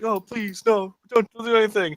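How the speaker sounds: high-pitched, imitated panicked begging; mock teen pleading. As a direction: plead like a busted teenager, hammed up. Acts out teens caught smoking begging.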